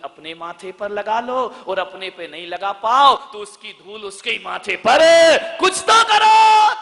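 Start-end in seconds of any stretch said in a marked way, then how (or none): 5.6-6.8 preaching shout. Real, not acted. Preacher urging daughters-in-law.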